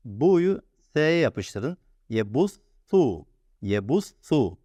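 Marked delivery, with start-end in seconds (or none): 2.1-4.6 "Ye bus su" with the s as a lisped th; tongue between teeth on "su" in "Ye bus su". Shown as a demonstration. Teacher demonstrating "Ye bus su" with a lisped s. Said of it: "Pelteyi unutmayın".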